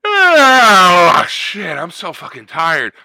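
imitating an obnoxiously loud yawner; exaggerated loud yawn. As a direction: yawn hugely, then complain as it dies away. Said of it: "the people that go".

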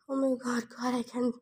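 real distress, struggling to go on; too upset to read. Real, not acted. Reader upset by the story, quietly overwhelmed. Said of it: "guys, I can't read anymore".

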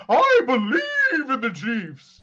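drawn-out, high, loud preacher-style proclamation; mock true believer. Co-host mocking blind faith in a team, mock true believer. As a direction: proclaim it like a mock church testimony. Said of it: "this isn't a church".

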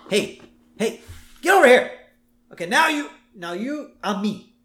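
high, loud mock command; intense actor. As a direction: boss him into the scene. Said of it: "James Woods".

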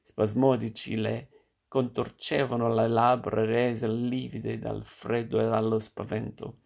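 ordinary delivery

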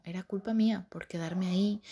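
usual speaking voice

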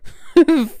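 short, high burst of giggles; amused laugh. Real, not acted. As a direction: crack up briefly at your own idea. Amused by napping on lap.

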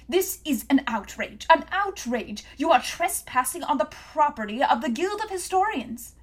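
high, loud indignant protest; shouting outrage at the intruders; outraged shouting. A narrator's reading. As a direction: bellow it like a pompous official caught out. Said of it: "the shouting voice quite clear".

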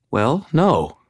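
high, hesitant woman's voice; confused. Acted. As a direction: answer unsure of yourself. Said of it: "now looking somewhat confused again".

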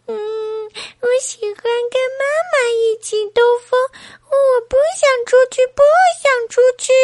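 acted tearful tantrum up high; crying baby. A narrator's reading. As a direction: bawl the refusal like a baby. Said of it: "宝宝又哭了起来".

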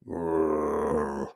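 low rumbling growl; deep animal growl; bear growl. Acted. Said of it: "growled Buster Bear".

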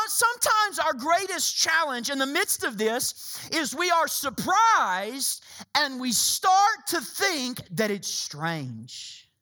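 ordinary delivery

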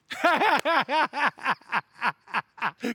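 big amused laugh; hearty laugh. Real, not acted. Laughs at own joke.

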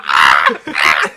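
very loud, low scream; goofy full-volume bellow; mock scream. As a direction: blast out a silly "ah". Goofy scream peaking the waveforms.

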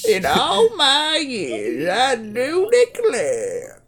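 high, slow, soft Southern belle; elderly Southern belle. Retold or imitated. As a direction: drawl it like an old Southern belle. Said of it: "She's a, an elderly Southern belle".

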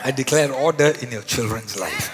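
preacher shouting declarations over the congregation; loud declaration. Real, not acted. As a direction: proclaim each line like a decree.

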